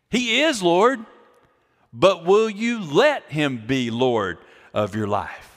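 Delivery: rising "He is Lord", then slow pointed question; preaching emphasis. Acted. Pastor challenging the congregation, slow pointed question. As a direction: press the question home slowly.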